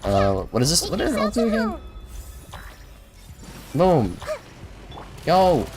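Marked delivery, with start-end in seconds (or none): none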